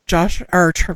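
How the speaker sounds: usual speaking voice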